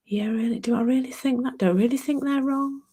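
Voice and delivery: questioning. Acted. Speaker voicing her own doubts about who is wrong, questioning. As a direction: wonder aloud, second-guessing your own certainty. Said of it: "there's just a questioning mind there".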